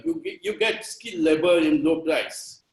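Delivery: ordinary talk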